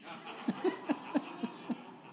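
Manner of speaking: laughing at the punchline; airy high chuckle; a preacher cracking up at his own joke; high breathy laugh. Real, not acted.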